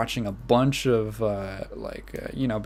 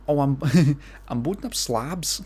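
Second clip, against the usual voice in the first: ordinary delivery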